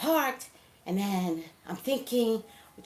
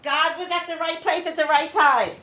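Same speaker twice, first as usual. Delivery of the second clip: fervent; 9 semitones higher, 8 dB louder than usual. Real, not acted. Testifying with blessing.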